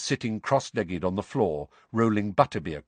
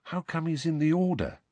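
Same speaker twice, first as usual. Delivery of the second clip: plain reading voice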